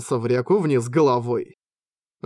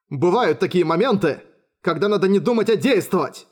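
rousing exclamation, pitched up; exclaiming; 4 semitones higher than usual. A narrator's reading. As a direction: call it out with resolve. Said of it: "воскликнул Йосихико".

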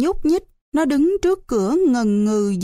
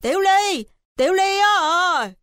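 calling across; 8 semitones higher than usual. A narrator's reading. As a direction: call out to a friend next door. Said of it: "gọi vọng sang". (gọi vọng sang, giọng cao kéo dài)

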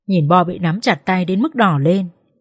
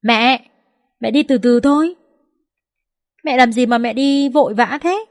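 higher-pitched, mildly raised voice; 5 semitones higher than usual. A narrator's reading. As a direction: call out to mother in mild protest. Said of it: "Có tiếng Dũng cất lên nói lớn".

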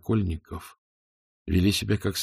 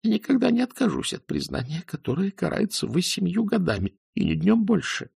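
high, strained refusal; cling to the confession in fear; desperate refusal; 11 semitones higher than usual. Acted. (в отчаянии, высоким голосом)

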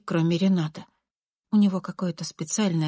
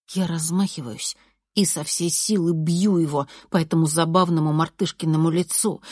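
plain reading voice

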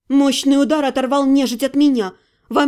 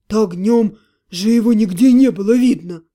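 puffing with strain; 5 semitones lower, 3 dB louder than usual. Acted. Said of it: "Отто пропыхтел, упираясь ногами".

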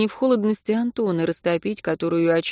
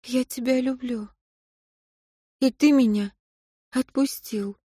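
quiet farewell; 6 semitones higher than usual. A narrator's reading. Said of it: "произнесла Катя ему в спину".

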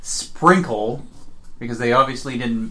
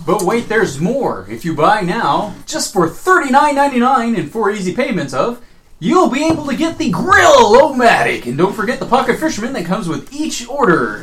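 infomercial; 8 semitones higher, 6 dB louder than usual. Acted. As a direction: sell it like a cheesy TV pitchman. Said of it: "The joke gets made everywhere".

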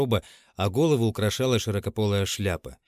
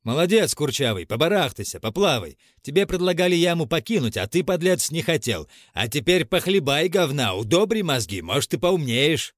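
издевательски подбадривая; 5 semitones higher, 3 dB louder than usual. A narrator's reading. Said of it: "Дьяков подбадривал убийцу".

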